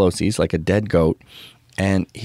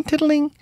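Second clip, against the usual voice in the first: imitated small noise. Imitating faint house noise.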